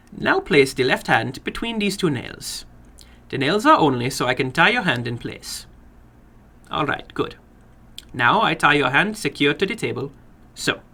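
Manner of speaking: a little man running his game with relish. Acted. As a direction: play the little man, keen and formal. Little man ties hand.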